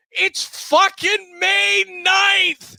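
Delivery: furious yelling out the car window, high and loud; angry shout. Retold or imitated. Host yelling at May snow out the window, loud furious shout. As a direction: bellow it at the blizzard. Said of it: "I go".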